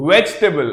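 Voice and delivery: Vegetable as "vej-tuh-bul"; three-syllable correct form. Shown as a demonstration. Teacher repeating it for class, Vegetable as "vej-tuh-bul". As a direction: say Vegetable without the middle vowel. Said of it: "The I or the E there is silent".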